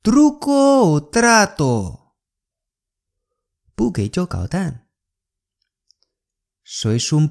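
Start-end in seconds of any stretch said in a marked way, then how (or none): 0.0-2.1 high slow Truco o trato; 慢速孩子喊; slow kid's call. Shown as a demonstration. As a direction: stretch out Truco o trato like a kid. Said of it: "慢一点".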